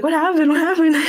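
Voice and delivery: mock the mother's worry through laughter; concerned mom. Friend's mom asking kids what happened, laughing mock concern. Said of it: "Corbin's mom was like".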